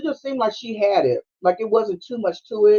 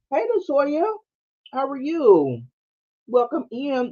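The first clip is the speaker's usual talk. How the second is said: a hint of a perky teller; lightly voiced higher cheerful greeting; mock bright customer-service greeting; bank teller greeting; 7 semitones higher, slower than usual.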